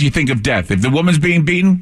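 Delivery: normal talking voice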